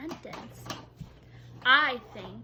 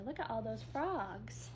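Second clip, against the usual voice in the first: usual speaking voice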